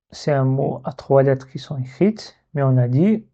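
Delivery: ordinary delivery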